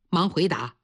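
usual speaking voice

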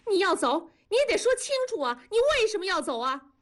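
high-pitched, desperate demand; strained cry. A narrator's reading. Wife demands why husband is leaving, strained high cry. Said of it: "嘶声地叫道".

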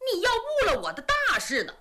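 sharp, high and fast; mildly angry high rebuke; glaring rebuke. A narrator's reading. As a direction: snap at him with wide eyes. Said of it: "金乌珠把眼一瞪".

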